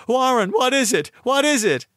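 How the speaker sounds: high, urgent, frightened questioning; terrified repeated demand, pitched high. Acted. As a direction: plead for an answer in rising panic. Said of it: "Terrified, I continued to repeat".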